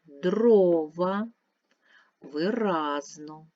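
slow word list, each word's stressed syllable stretched, from "Дрова" to "посередині"; each word alone, from "Дрова" on, stressed syllable swelled and lengthened; stressed syllables drawn out. Shown as a demonstration. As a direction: dictate "виразно, сантиметр, листопад" slowly, leaning long on each stressed vowel. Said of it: "прочитаємо вголос слова і розставимо наголоси".

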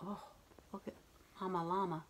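ordinary delivery, nothing marked

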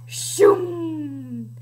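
slow drawn-out whoosh; fly-away sound. Shown as a demonstration. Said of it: "You wanna demonstrate it".

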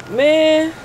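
loud high exclamation; big excited "Man". Real, not acted. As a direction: burst out at the sight. Sees the new car.

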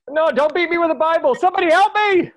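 high, loud frantic cry for help; panicked plea. Acted. Zach fleeing Vicky and her Bible, panicked shouting. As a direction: beg as she chases you.

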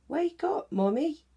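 high, playful wake-up call; squeaky cheeky child voice; giggly little monkey. A narrator's reading. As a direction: tug at mum and giggle it out. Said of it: "giggling and tugging it".

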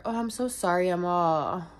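normal talking voice

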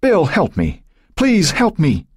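high, desperate plea for help; panicked pleading call. A narrator's reading. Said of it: "she called out".

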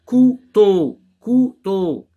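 loud, high "Couteau" as cou, teau; split into syllables. Shown as a demonstration. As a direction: call out "Couteau" in two separate beats. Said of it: "Deux syllabes seulement".

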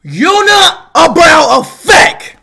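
long, stretched excited shout; hyped shout-out. Real, not acted. Streamer answers a fan's shout-out request, hyped. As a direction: stretch the name out like a big announcement. Hypes name from donation message.

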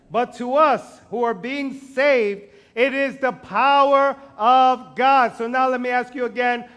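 slow, loud preacher proclamation; full-voiced sermon declaration; preaching. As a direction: drive it home from the pulpit. Preaching climax.

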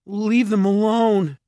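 high, loud and drawn out; frightened high-pitched demand; shaky plea. A narrator's reading. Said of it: "Jared said shakily".

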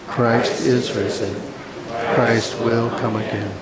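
ordinary talk, nothing marked

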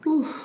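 high breathy whew; whew from the spice. Real, not acted. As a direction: blow out the heat of spicy food. Spicy wings heat.

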